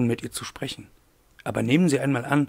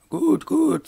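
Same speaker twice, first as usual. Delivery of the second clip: high, loud lady's character voice; brisk mademoiselle; 5 dB louder than usual. A narrator's reading. Aglaé hurries Wolf along, brisk high lady voice. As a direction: cut in briskly, pitched high. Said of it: "sagte er gleich schnell".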